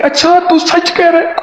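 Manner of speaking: high, disbelieving "اچھا"; a wife caught off guard by the news; amazed wife. Retold or imitated. Wife reacts on the phone. (حیرت زدہ بیوی کی اونچی آواز)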